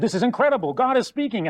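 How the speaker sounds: a burst of giddy wonder; excited angels. Retold or imitated. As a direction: gush like awestruck angels. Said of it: "they get all excited".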